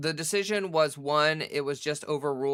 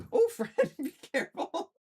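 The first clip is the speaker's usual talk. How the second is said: much higher, sudden startled laugh; surprised laughing warning to the cat; startled cry. Real, not acted. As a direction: yelp at the cat climbing on you. Cat steps on her.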